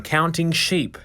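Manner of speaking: usual speaking voice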